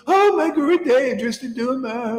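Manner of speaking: high, gushing adoring woman; swooning devotee. Retold or imitated. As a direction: gush like a starstruck fan. Women in saris adoring guru.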